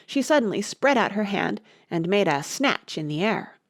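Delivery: ordinary delivery, nothing marked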